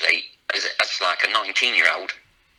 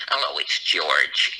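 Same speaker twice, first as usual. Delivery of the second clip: low, slow mock phone greeting; a Lancashire man's take on George; impression of George Wilkinson; 9 semitones lower, much slower than usual. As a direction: drop low and drawl it out like George. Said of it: "did an impression of George Wilkinson".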